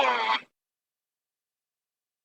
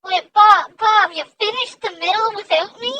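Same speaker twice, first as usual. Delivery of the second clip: loud protest; 5 semitones higher, 9 dB louder than usual. Real, not acted. Left behind by the painting video.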